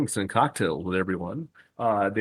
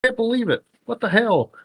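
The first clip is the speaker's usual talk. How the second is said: stunned crowd; 10 semitones higher than usual. Retold or imitated. Fans seeing three Stings, shouting high in confusion. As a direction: yell in confused amazement.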